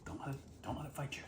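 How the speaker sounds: soft unvoiced whisper. Real, not acted. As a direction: whisper it close. Whispers to partner serving.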